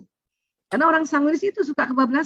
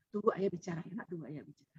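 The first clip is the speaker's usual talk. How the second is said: put-on very soft, low aside to a child; hushed, as if whispering a reminder to her child; retold whisper; 6 semitones lower, 15 dB quieter, faster than usual. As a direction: drop right down to an imitated whisper. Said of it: "saya bisikin".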